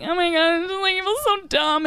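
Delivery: high tearful wail; crying. Retold or imitated. Crying to the coach in her car. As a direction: sob it out, mock-tearful. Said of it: "I'm like".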